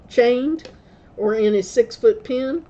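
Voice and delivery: ordinary delivery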